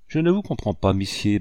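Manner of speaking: usual speaking voice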